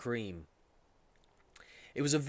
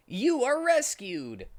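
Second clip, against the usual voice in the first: higher, slow triumphant hero voice; Sonic character voice; 11 semitones higher, 5 dB louder, slower than usual. Acted. Sonic freeing Charmy from cage, slow heroic voice. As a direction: announce the rescue proudly.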